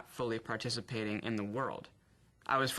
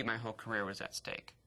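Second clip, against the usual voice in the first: ordinary talk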